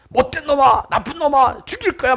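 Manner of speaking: high-pitched acted boyish curses; angry cursing. Boy curses toward the hills, imitated high angry cries. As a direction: fling out one curse after another. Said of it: "막 그랬는데".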